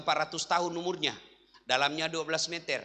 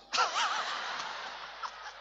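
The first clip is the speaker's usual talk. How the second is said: high breathy laugh; laughing at his own punchline; 7 dB quieter than usual. Real, not acted. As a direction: burst out laughing. Preacher laughs at own joke.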